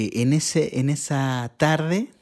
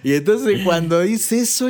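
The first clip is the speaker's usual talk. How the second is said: ordinary delivery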